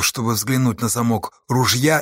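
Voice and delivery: plain reading voice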